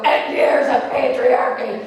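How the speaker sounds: loud, slow, drawn-out bellow; hammed-up furious yell; enraged shout. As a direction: roar out the grievance. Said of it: "I was in a rage".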